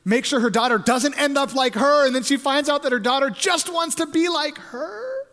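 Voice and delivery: high, loud preacher's build; rising, urgent pulpit delivery; impassioned preaching. Real, not acted. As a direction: preach it hard, voice climbing.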